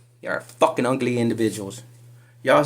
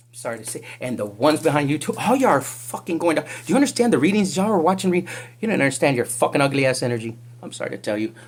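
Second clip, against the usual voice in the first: normal talking voice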